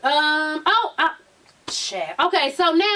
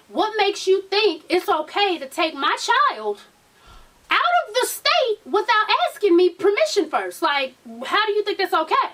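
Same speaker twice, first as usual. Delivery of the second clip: indignant confrontation; 6 semitones higher than usual. Acted. Acting baby mama confronting Yandy.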